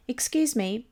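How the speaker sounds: ordinary talk, nothing marked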